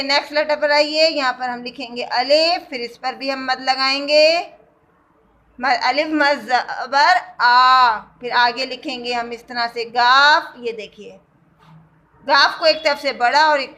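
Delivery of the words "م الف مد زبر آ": آ drawn out slowly; stretched aa. Shown as a demonstration. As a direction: hold آ for two beats. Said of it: "الف کو ہم کھینچ کر پڑھتے ہیں".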